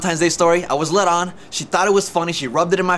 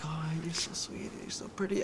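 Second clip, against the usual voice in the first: much softer, near-hushed sweet talk; 16 dB quieter than usual. Retold or imitated. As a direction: coo the compliments softly to win her over. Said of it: "I sweet talked her".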